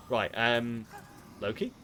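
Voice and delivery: ordinary delivery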